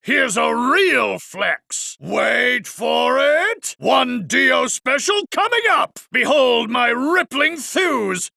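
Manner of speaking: slow, high, grandstanding swagger; showman boast. Acted. Park director showing off his muscles, slow and pompous. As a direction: draw it out like a bodybuilder on stage. Dio posing for the crowd.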